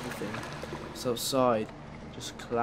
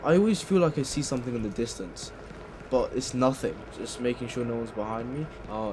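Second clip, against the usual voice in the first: normal talking voice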